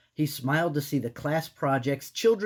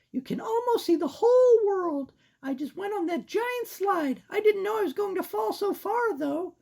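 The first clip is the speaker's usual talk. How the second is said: put-on squeaky girl mouse; high-pitched cartoon mouse; 4 dB louder than usual. Voicing Sarah.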